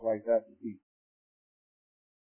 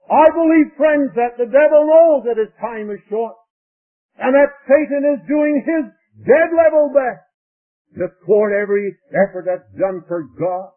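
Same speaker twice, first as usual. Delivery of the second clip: high, thundering pulpit conviction; full-force preaching; 7 semitones higher, 11 dB louder than usual. Real, not acted. Preacher warning friends of Satan's effort, full-force preaching. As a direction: hammer it home to the congregation.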